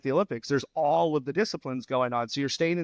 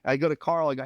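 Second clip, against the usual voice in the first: normal talking voice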